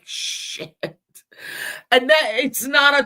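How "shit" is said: unvoiced whispered curse. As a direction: breathe it out like a beaten horse. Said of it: "that horse is like".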